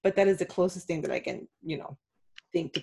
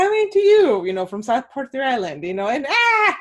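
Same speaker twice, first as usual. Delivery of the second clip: mock weatherman scream; 5 semitones higher, 6 dB louder than usual. Imagined weatherman attacked by alligator.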